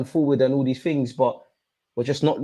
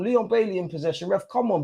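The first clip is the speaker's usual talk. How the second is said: normal talking voice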